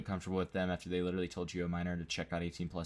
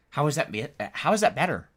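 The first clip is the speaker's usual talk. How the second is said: incredulous outburst; 7 semitones higher, 8 dB louder than usual. Real, not acted. Outraged at the post.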